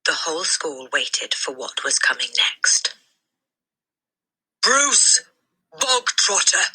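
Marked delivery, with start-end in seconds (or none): 4.6-6.7 sudden high bark of a name. A narrator's reading. The headmistress barks a boy's name at assembly. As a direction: bark the name like a drill sergeant. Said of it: "the Trunchbull barked".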